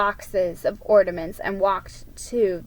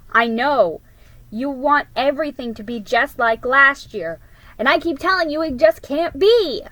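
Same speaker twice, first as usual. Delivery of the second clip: louder, higher impatient retort; 5 semitones higher, 6 dB louder than usual. Acted. Older sister arguing with younger sister, impatient raised voice. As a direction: snap back impatiently. Sister snaps back in argument.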